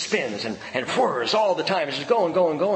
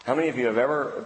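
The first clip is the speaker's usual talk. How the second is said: usual speaking voice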